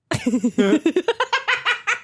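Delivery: high whooping cackle like a kookaburra; kookaburra laugh. Real, not acted. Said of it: "that's a good Kookaburra impression".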